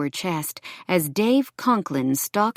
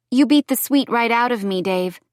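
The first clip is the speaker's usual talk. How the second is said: higher, louder, mildly defiant retort; 7 semitones higher, 4 dB louder than usual. A narrator's reading. Woman confronts her abusive ex, defiant. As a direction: stand up to him with a raised voice.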